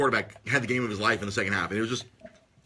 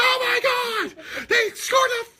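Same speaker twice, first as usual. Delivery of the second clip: much higher, louder drawn-out announcer yell; Gus Johnson screaming; 4 dB louder, much slower than usual. Retold or imitated. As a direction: lose it like a wild play-by-play caller. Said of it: "He freaking screams in the mic".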